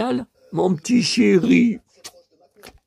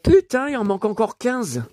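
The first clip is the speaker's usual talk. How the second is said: ordinary talk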